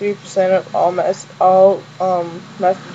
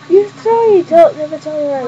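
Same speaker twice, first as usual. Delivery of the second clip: mock scolding; 8 semitones higher than usual. Acted quarrel between show characters.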